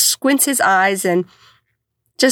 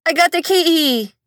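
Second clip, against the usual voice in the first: put-on toddler voice; 8 semitones higher than usual. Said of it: "he just goes".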